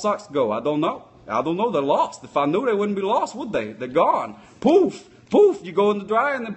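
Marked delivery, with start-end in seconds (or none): 4.6-5.6 high, loud popping poof; loud sudden magic poof. Acted. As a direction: burst it out like a magic trick. Socks vanish in the dryer.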